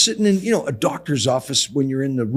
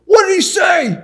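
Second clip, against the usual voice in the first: much higher, loud shout of a mother who can't hear; deaf mother shouting; 11 dB louder than usual. Retold or imitated. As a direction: yell across the room, not hearing a word.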